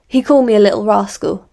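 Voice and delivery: loud, exasperated outburst. A narrator's reading. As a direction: fling it out impatiently. Polly snaps, exasperated.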